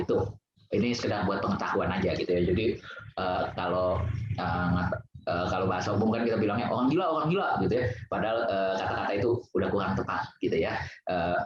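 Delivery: usual speaking voice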